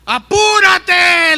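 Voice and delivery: high, loud, slow impatient yell; injured man yells up at his rescuer to hurry; desperate shout. Acted. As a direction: blurt out in panic. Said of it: "en su desesperación".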